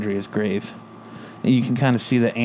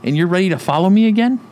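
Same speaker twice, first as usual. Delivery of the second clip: mocking Negan; 5 semitones higher than usual. Negan taunts Mark about his burned face, lightly imitated, incredulous. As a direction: sneer it up at him like Negan, can't believe him. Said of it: "He's like".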